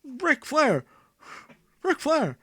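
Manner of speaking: lightly hammed-up Mean Gene; Mean Gene impression.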